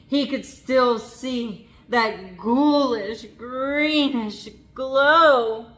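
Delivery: slow, loud spooky reveal; spooky build. A narrator's reading. Narrator spooking young listeners, slow and loud. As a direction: draw out the glow like a ghost story.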